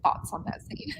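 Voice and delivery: teasing whisper; playful hushed whisper. Real, not acted. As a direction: whisper it conspiratorially. Teasing about a spicy scene.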